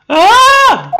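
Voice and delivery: high, loud sudden "Ah!"; startled scream. Real, not acted. Player startled as the girl vanishes, high yelp. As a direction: yelp in shock.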